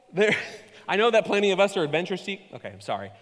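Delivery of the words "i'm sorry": normal talking voice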